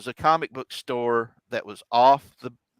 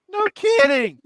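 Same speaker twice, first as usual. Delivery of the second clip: very high, loud falsetto mom voice; mock mom squeal; 7 dB louder than usual. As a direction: squeal like an amazed mother.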